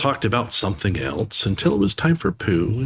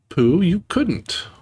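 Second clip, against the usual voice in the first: higher, slow little Piglet; 11 semitones higher, much slower than usual. Acted. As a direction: draw it out like a timid pig. Piglet's imagined reply.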